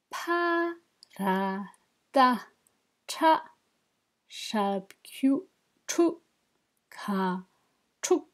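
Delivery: slow, syllable by syllable. Shown as a demonstration. Teacher rereads Tibetan syllables for students, slowly. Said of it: "je vais le relire plus lentement".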